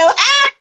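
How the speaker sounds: high laughing squeal; delighted shriek. Real, not acted. Laughing at the host's NPR joke with a high shriek. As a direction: let out a gleeful shriek.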